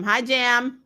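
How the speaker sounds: ordinary talk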